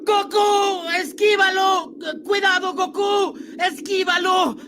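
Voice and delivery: very high frantic warning yell; Krillin shouting. Acted. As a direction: scream the warning in panic. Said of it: "Krillin grita".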